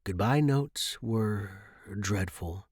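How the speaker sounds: ordinary delivery, nothing marked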